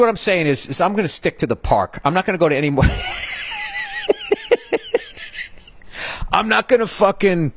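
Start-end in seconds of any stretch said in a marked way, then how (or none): 2.9-5.5 squealing laugh, jumps high; high shrieking laugh. Real, not acted. Comedian shrieking with laughter at his own joke. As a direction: crack up shrieking at own line.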